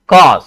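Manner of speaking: कॉज said loud and held; strong, emphatic stress on कॉज; stressed syllable. Shown as a demonstration. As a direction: punch the word कॉज. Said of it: "कॉज में stress हो रहा है".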